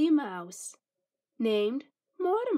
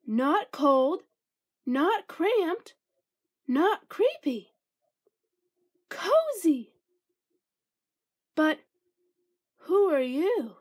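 squeaky mouse voice; 5 semitones higher, 5 dB louder, slower than usual. A narrator's reading. Mouse delights in tiny house.

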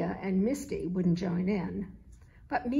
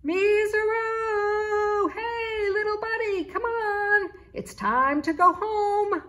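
high, loud sing-song call; 11 semitones higher, 7 dB louder, slower than usual. A narrator's reading. Charlie searching the street for the kitten, sing-song call. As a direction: stretch the name out, calling down the street. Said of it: "calling out to Meeser".

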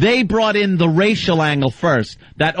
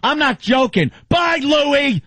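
angry shout; 8 semitones higher than usual. Real, not acted. Host banning a caller.

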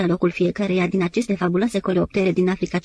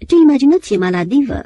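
higher, louder barely held exasperation; louder cry of exasperation; exasperated outburst; 6 semitones higher, 7 dB louder than usual. Acted. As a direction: burst out, fed up. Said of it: "cu o greu stăpânită exasperare".

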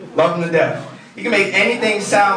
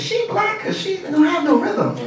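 high mocking voice; 12 semitones higher than usual. Mock question about relative.

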